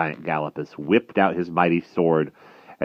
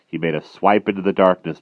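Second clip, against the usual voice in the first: ordinary delivery, nothing marked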